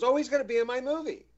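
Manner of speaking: high-pitched, lightly acted director's reply; imitated high-pitched exclamation; Ron. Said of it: "And he goes".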